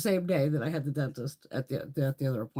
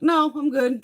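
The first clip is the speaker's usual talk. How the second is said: breezy dismissal; 7 semitones higher, 6 dB louder, slower than usual. Retold or imitated. Retold dismissive answer.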